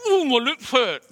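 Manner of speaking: loud, high comic whimper of pain; mock hurt Isaiah. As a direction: play burnt lips for laughs. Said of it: "And Isaiah went".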